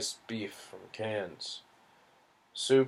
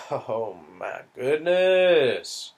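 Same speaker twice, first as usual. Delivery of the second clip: amused; 3 semitones higher, 5 dB louder, much slower than usual. Real, not acted.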